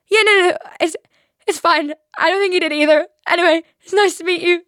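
flustered. Retold or imitated. Flustered self-quote after embarrassing mix-up.

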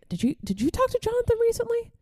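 very high-pitched innocent question; feigned innocence. Retold or imitated. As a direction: set up a prank with a stammered start. Retold innocent setup of second prank.

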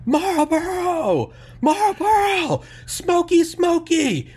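very high, slow, loud drawn-out yells; yelling customer. Retold or imitated. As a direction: stretch each word into a desperate shout.

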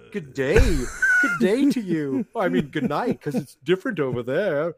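playful, exaggerated Australian accent; put-on Australian accent. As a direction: greet them in a mock Aussie accent. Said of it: "This is my accent".

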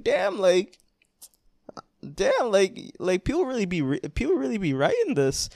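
imitated teary amazement; mock near-tears awe. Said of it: "I'm about to start crying".

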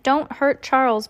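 high, anxious appeal; alarmed plea. A narrator's reading. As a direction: plead to spare someone. Translation of baby's frightened shriek.

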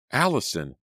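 high surprised cry of a name; calling out. A narrator's reading. Girl calls out as her injured friend moves, high and startled. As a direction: call out at the sight of her.

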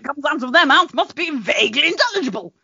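high, laughing mock awe; hammed-up gushing over British speech; imitating impressed listeners. Foreigners assuming Brits sound intelligible, mock awed. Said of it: "everyone just assumes".

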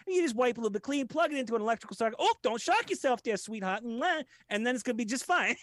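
high, fast, sugary put-on coaxing; baby the listener like a sweetheart; hammed-up tender voice; mock doting coo.

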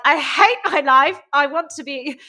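hammed-up wail of self-pity; mock misery. Voices a miserable luteal-phase woman.

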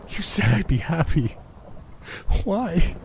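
high, broken cry of grief; anguished cry. Acted. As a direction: scream the why through tears. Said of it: "screaming and seeing red".

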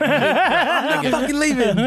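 slow, high laughing refusal; laughing defiance. Retold or imitated. Friend mocking the defiant son, high slow laughing. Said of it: "I was at my own home".

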